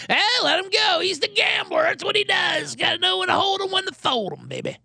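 high, shouted, gleeful boss; mock boss voice. Casino boss telling security to let Kenny go, high gleeful shout. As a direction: cheer him on like an amused casino boss.

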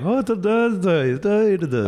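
lilting nonsense Swedish chef babble; high, bouncy mock Swedish babble; mock Swedish singsong. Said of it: "I also have no idea what Finns sound like".

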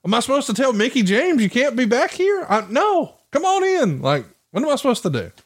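high-pitched mock helplessness; exasperated disbelief. Promoter retelling his dilemma over backstage guests, exasperated. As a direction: plead the absurdity, pitched well up.

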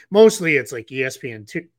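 talking through hiccups; hiccupping mid-sentence. Real, not acted. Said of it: "I have the hiccups".

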